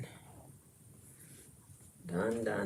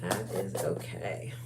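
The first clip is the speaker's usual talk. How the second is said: whispered reassurance; 10 semitones lower than usual. Real, not acted. Reassuring self about craft.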